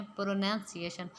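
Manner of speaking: ordinary delivery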